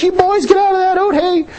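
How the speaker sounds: put-on high-pitched old grandma calling out; grandma yelling. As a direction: imitate grandma hollering from the edge of the field. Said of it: "she was yelling at us".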